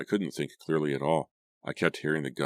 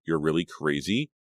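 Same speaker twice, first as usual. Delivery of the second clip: usual speaking voice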